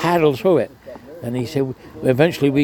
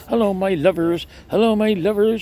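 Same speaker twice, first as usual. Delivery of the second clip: higher, sing-song hello to the passing lads; Ermy's greeting; 8 semitones higher than usual. Retold or imitated. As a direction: call down cheerily from the window ledge, pitched well up. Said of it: "she used to say".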